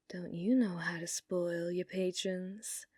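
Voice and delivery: whisper. Acted. Whispered teasing to bartender.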